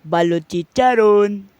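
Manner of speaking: drawn-out, loud street cry; loud vendor cry. Acted. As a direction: call it out slowly across the street. Vendor calling wares.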